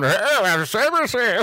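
high, slow, hammed-up old man; goofy high old man voice. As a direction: overdo a silly old man voice. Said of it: "without going".

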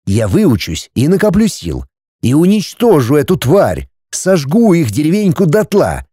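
plain reading voice

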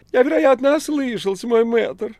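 very high, loud cat voice; affronted talking cat, high-pitched; indignant cat Behemoth. Acted. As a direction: squeal it in affected disbelief. Cat answers the check.

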